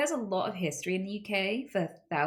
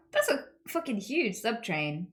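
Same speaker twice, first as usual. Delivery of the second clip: ordinary talk, nothing marked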